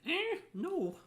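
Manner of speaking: sudden high yelp of refusal; startled no. Real, not acted. Streamer reacting to a mess while eating, high startled no. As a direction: squeak out a quick no.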